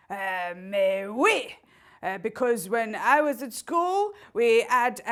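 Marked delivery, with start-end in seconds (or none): 0.1-1.6 slow, smug French "mais oui"; haughty French-accented reply. Acted. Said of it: "French accent".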